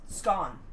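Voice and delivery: "scone" as "skon", rhyming with gone; low, falling "skon" for "scone"; correcting pronunciation. Shown as a demonstration. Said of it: "No, the, the".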